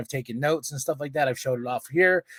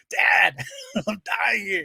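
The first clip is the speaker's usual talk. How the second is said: higher mock protest, breaking into laughter; comic whine for attention; exasperated plea; 7 semitones higher, slower than usual. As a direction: call out to an oblivious dad. Pleading with laughing dad.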